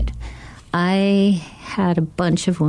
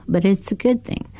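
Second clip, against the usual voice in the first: ordinary talk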